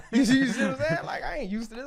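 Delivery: high, loud laughing talk. Real, not acted. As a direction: crack up mid-sentence. Laughing at the memory.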